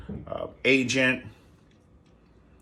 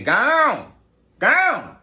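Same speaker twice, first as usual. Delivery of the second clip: shouted dismissal; 11 semitones higher, 8 dB louder than usual. Acted. Comic dismissal, shouted high.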